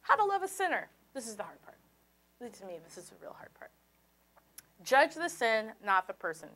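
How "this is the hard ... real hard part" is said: fast whispered aside. Real, not acted. Preacher confiding to the congregation in a quick whisper. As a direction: drop to a quick whisper as a confession.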